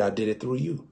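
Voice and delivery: Jesus, quiet. Retold or imitated. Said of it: "But he said".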